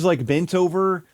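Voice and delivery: ordinary talk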